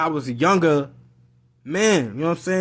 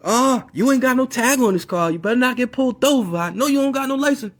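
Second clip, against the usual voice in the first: high, louder mock scolding; scolding mom; 9 semitones higher, 4 dB louder than usual. Mom doubting son has tag or license, high scolding. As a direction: imitate a mother's high-pitched fussing.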